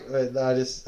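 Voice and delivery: ordinary delivery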